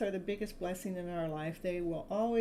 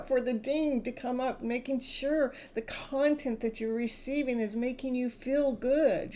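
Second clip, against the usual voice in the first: normal talking voice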